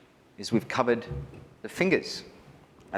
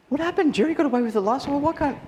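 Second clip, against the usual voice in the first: very high, louder whiny protest; whiny teenage self; 4 dB louder, faster than usual. Retold or imitated. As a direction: kid sulking that it didn't work. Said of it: "I just went".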